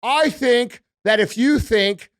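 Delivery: slow, drawn-out mock politician; mocking speech with heavy, deliberate emphasis; Kamala impression. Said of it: "another woman who talks like this".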